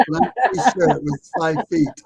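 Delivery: ordinary delivery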